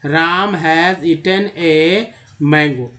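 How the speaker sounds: राम has eaten a mango read slowly as words, not letters; slow model reading of the words; correct reading. Shown as a demonstration. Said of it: "ऐसे ही पढ़ना है".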